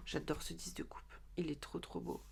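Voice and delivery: whispered in awe, unvoiced; chuchoté. Real, not acted. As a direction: breathe it out in a whisper. Admiring a tarot card. (murmuré avec admiration)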